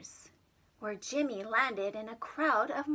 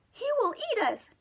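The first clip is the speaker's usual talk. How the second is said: higher, louder squeaky cry; frightened Marsman; 11 semitones higher, 4 dB louder than usual. Acted. Marsman crying out in fear about the monster. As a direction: squeal it in alarm. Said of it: "cried Marsman three hundred sixty-five".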